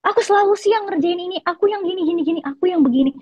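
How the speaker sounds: high, whiny imitation of a partner listing favors; mocking a partner's complaints. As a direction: mimic him bragging about his sacrifices. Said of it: "ngungkit-ngungkit kebaikan yang dia kasih".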